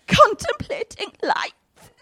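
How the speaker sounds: mock dreamy teen. Preacher mocking his sister's dreamy teenage moods, high swoon. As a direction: sigh it like a lovesick teen.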